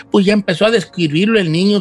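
ordinary delivery, nothing marked

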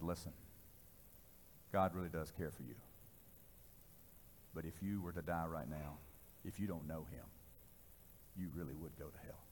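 low, soft, earnest plea; hushed, sincere warning; solemn appeal. Retold or imitated. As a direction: speak gently and gravely to one man. Gently witnessing to the sobered man.

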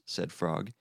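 ordinary delivery, nothing marked

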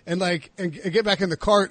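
ordinary talk

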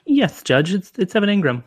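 Kermit the Frog. Acted. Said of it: "Kermit the Frog was here earlier".

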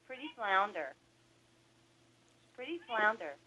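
soft coaxing parrot voice. Pretend. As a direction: coax the bird gently. Prompting parrot.

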